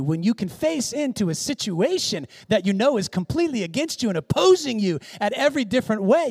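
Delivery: normal talking voice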